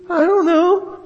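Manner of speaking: put-on high, small child's reply; squeaky pretend child; little kid voice. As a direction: answer like a shy little kid. Voicing a little kid answering.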